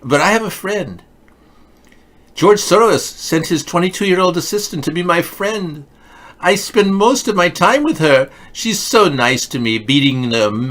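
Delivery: high, hammed-up girl voice. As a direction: keep the squeaky girl act going. Continues high put-on voice.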